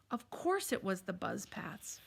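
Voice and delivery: ordinary delivery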